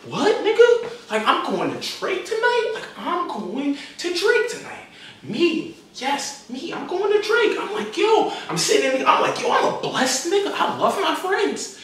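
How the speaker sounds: high, hushed squeal of amazement; retold overjoyed squeaky whimper; giddy disbelief. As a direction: marvel in a soft, very high voice. Retold giddy disbelief about going.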